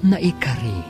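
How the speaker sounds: ordinary talk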